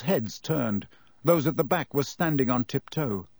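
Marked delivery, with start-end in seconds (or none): none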